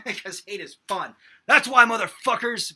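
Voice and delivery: loud, high joking yell; mock shout. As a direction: bellow it as a joke.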